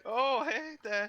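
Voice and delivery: high, startled greeting; mock surprise. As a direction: caught off guard, squeak it out. Pretend meeting in public.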